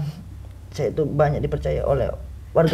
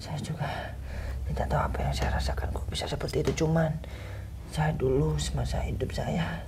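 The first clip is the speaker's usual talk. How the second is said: whispering; 8 dB quieter than usual. Acted. Possessed spirit confessing in whisper.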